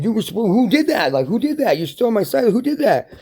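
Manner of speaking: high-pitched acted outrage; hammed-up indignant questioning; furious demanding. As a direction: demand to know who did it. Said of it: "when you get up and you're furious".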